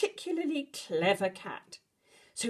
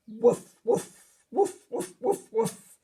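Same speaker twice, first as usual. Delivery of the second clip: cheerful doggy woofs; dog barking; 3 semitones higher, 5 dB louder than usual. Acted. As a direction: bark like a friendly dog. Dog Bear barking in story.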